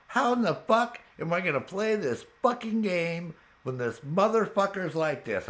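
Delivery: retold rant, pitched high; exasperated rant. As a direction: act out being fed up with the game. Retelling own rant at game.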